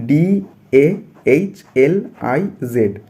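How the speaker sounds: "D A H L I Z" spelt out very slowly, letter by letter; slow spelling. Shown as a demonstration. Teacher spells the word for students, very slowly. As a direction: drag out each letter of "D A H L I Z". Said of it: "मैं यहाँ पे आपको धीरे धीरे बोल रहा हूँ".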